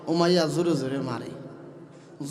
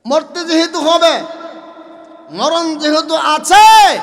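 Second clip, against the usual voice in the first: higher, louder, slow fiery sermon; preaching at full force; 11 semitones higher, 13 dB louder than usual. Acted. Preacher rousing the congregation, loud and slow. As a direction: hammer it home to the crowd.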